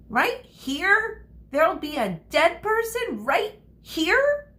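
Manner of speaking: put-on high, excited questions from a little girl; amazed child. Little girl startled by the news, high amazed question. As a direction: squeal it out in wide-eyed wonder.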